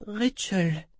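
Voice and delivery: put-on high child's voice; little girl, slightly reassured. A narrator's reading. Child telling the man her name, a little reassured. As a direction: answer in a small, high voice. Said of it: "un peu rassuré".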